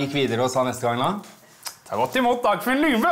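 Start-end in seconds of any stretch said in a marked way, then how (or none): 1.9-3.1 announcer. Retold or imitated. Introducing Dagfinn on stage.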